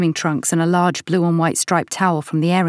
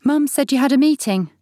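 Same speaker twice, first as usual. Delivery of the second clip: put-on young boy voice; 10 semitones higher, 4 dB louder than usual. A narrator's reading. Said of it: "Oliver says".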